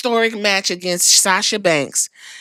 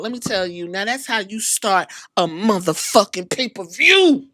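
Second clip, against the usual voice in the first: normal talking voice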